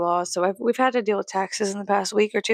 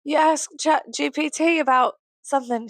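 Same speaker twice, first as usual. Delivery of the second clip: higher-pitched, clumsy Scottish imitation; bad Scottish accent; 6 semitones higher, 3 dB louder than usual. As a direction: attempt a Scottish woman's accent badly. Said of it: "I can't do a Scottish accent".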